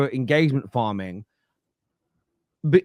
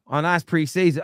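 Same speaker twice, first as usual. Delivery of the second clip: ordinary talk, nothing marked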